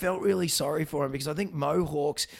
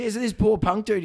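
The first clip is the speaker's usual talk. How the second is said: normal talking voice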